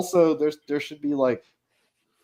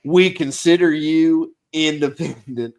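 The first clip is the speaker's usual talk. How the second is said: high, loud, slow officious voice through laughter; pompous boss voice; 6 semitones higher, 6 dB louder, slower than usual. Retold or imitated. Game master voicing the stingy NPC boss. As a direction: play the smug employer, cracking up. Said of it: "he's like".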